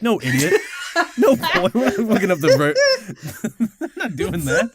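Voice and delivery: scolding through laughter; mock grandparent refusing, laughing. Grandparents scolding in retold story.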